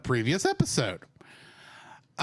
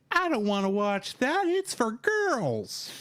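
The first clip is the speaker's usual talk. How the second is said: put-on bratty kid; 8 semitones higher, slower than usual. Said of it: "kind of a, a outlook on it".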